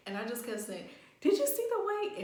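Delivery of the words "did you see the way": very high, loud squeal; retold cracking up. Telling how they kept saying it to Alex, retold cracking up. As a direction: squeal it out, imitating helpless laughter. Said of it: "I was crying, like, cracking the fuck up".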